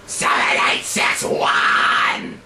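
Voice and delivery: unvoiced high-pitched scream, no heat; high scream without heat. Shown as a demonstration. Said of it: "That's without heat".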